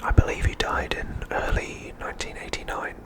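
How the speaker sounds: ordinary talk, nothing marked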